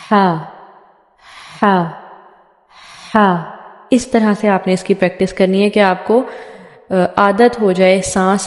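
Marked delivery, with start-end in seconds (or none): 0.1-3.5 हा with the breath pushed out first, then the voice; हा said slowly, air before sound; breathy ha demo. Shown as a demonstration. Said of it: "पढ़ के भी इसी तरह बताती हूँ".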